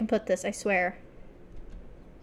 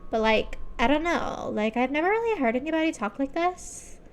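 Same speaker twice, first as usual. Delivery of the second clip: put-on Valley Girl voice lifting higher; 4 semitones higher than usual. Shown as a demonstration. Streamer showing off an accent she doubts, Valley Girl. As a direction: talk like a Valley Girl, pitch drifting up. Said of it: "I can do a Valley Girl".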